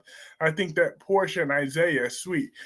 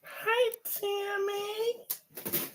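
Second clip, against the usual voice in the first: put-on sweet baby-talk greeting; 13 semitones higher, 11 dB quieter, much slower than usual. Greeting departing child actor.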